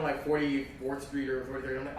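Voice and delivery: ordinary delivery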